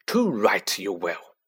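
low slow forceful uncle voice; forceful gruff uncle. A narrator's reading. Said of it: "said Uncle Vernon forcefully".

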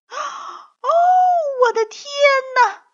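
high-pitched screamed exclamation; gasp, then shriek as if seeing a monster; panicked ladies screaming at a lion; terrified shriek. A narrator's reading. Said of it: "三位太太尖叫着转身就跑".

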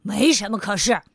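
firm loud cutoff; grumpy man shutting down the question; stubborn retort. Acted. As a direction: refuse to give an inch. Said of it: "不依不饶的".

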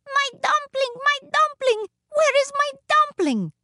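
very high, frantic little woman; high-pitched hue and cry; shouting while running. A narrator's reading. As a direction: call out in a high, piping shout. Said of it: "she shouted as she ran".

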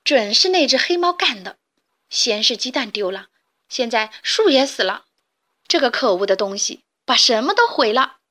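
loud, bitter blame; angry grumbling. Acted. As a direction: spit the complaint at the cat. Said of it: "小鸡们抱怨着".

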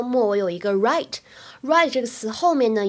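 usual speaking voice

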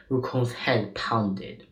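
usual speaking voice

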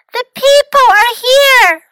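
high, loud panicked yell; yelled alarm. A narrator's reading. City mouse warning country mouse of people, loud high yell. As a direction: shout the alarm as if people are coming. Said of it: "yelled City Mouse".

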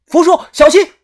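high, loud cry of alarm; shouted warning. A narrator's reading. Narrator warns uncle Fu of the creature, shouting. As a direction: yell it to warn him. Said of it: "我看到就喊了".

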